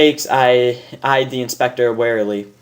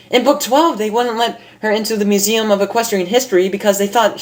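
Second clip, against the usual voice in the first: much higher, quick eager pony; excited Rainbow; 9 semitones higher than usual. Acted. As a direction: race through the plan. Rainbow plotting in story.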